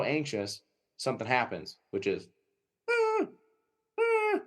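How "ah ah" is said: high, thin mock dog whine; whining dog imitation. Imitating an anxious dog whining in the blind, high mock whine.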